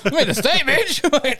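giggly mock triumph; laughing gloat. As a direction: crack up while bragging. Friend jokingly voices him gloating.

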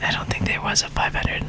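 muttered under the breath; whispered aside. Real, not acted.

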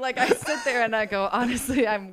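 real laugh breaking through the words; can't hold back the giggle; laughing while telling. Real, not acted. Laughing while retelling her answer.

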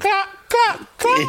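high, loud sassy chicken clucks. Pretend. Co-host playing a sassy chicken, high and loud. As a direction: play a sassy chicken snapping back.

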